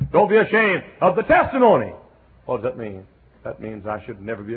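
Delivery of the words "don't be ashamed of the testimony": high, loud with "testimony" falling hard; preached shout. Acted. Preacher shouts the warning to the congregation. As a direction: drive it home.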